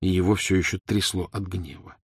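ordinary delivery

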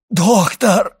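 loud, high cry for help; screaming. A narrator's reading. Dying servant calls the doctor, screaming. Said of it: "кричал Барруа".

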